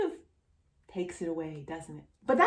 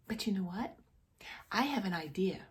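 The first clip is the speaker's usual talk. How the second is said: playful conspiratorial whisper; secret whisper; 8 dB quieter, faster than usual. Pretend. Teacher to child after the story, whispering an idea.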